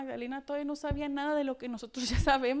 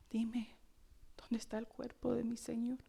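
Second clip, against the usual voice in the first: hushed, breathy grieving whisper; whispered lament; whispered plea; 9 dB quieter than usual. Acted. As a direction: whisper it as she searches. Repeats Mary's whispered plea.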